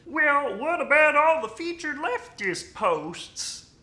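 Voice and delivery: high, loud mock-critic voice; silly objector voice. Imagined iFunny user raising a criticism, silly high voice. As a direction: pitch it way up and draw out the objection. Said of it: "What was that voice?".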